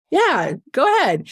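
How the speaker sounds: a light take on a jolly big guy agreeing. Retold or imitated. The man in the wheelchair agreeing to hear her, lightly voiced jolly guy. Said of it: "that happy-go-lucky, big, jolly guy".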